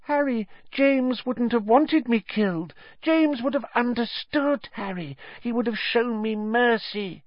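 high, squeaky, wheedling plea; high-pitched grovelling appeal; pleading coward. A narrator's reading. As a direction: beg for mercy in a high voice.

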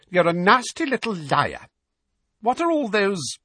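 high-pitched, angry accusation; accusing aunt. A narrator's reading. Aunt accuses Harry angrily.

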